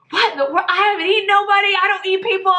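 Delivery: high, panicked, rushing denial; freaking out. Retold or imitated. Accused friend panicking at the police, high and fast. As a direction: protest your innocence in a panic. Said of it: "She starts freaking out".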